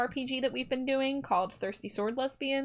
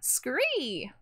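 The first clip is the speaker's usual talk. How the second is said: bat screech; 3 semitones higher than usual. Pretend. Playful bat noise after smooches.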